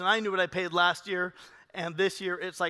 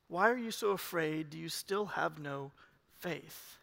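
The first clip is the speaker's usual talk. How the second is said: quiet reproach; 4 semitones lower, 6 dB quieter than usual. Retold or imitated. Jesus gently questioning the disciples.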